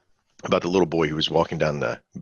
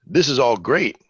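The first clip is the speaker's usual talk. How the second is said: high, louder burst of mock optimism; punchy, raised-pitch excitement; enthusiastic; 7 semitones higher, 5 dB louder than usual. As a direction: play the eager newcomer, sold on it. Acts out trader's eager perception.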